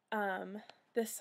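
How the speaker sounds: ordinary talk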